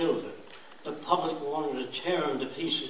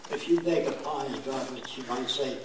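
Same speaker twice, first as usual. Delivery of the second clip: normal talking voice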